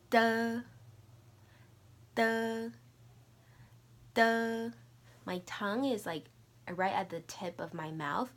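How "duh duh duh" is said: higher, louder, crisp "Duh" repeated; letter sound demo. Shown as a demonstration. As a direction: model the sound "Duh" clearly, tongue tip down. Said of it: "I'm gonna say it three times each".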